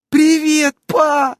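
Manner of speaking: high, loud happy shout to dad; loud, high joyful hello; joyful yell. A narrator's reading. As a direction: yell a glad greeting. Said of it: "радостно завопила Эвелина".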